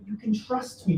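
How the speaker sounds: ordinary talk, nothing marked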